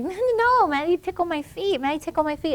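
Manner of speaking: put-on very high, squealy little-girl voice; be the toddler giggling and begging; mother's high-pitched mimic of her laughing daughter; imitating giggling toddler. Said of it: "And she started laughing".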